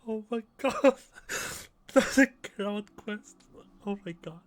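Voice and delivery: very high, loud exclamations between laughs; hysterical laughing. Real, not acted. Player reacting to game joke, laughing hysterically. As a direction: gasp it out in a laughing fit.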